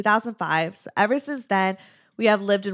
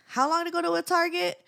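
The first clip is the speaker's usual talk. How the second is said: mock disbelief; 10 semitones higher than usual. Retelling suburban shock.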